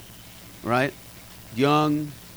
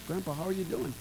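soft voice; 3 semitones higher, 12 dB quieter, faster than usual. Retold or imitated. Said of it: "I would just sit there".

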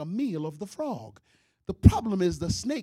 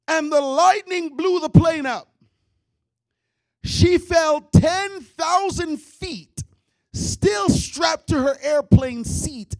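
dramatic shout; 6 semitones higher, 6 dB louder, slower than usual. Acted. Dramatic telling of the fall.

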